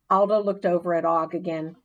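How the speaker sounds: ordinary delivery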